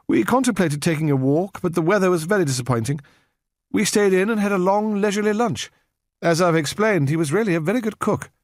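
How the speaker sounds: a Victorian lady making polite conversation; courteous, distant spinster; prim elderly lady. A narrator's reading. Miss Hunt giving her alibi.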